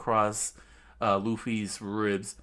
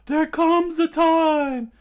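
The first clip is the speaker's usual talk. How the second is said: screamed, much higher and louder; screaming; 12 dB louder than usual. Acted. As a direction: scream it out desperately. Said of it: "he screamed out".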